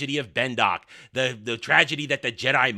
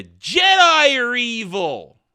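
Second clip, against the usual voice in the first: loud, high, drawn-out declaration; mock proclamation; 7 semitones higher, 9 dB louder, much slower than usual. Critic mocking the show's agenda, exaggerated proclamation. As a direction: belt it out slowly like a damning slogan.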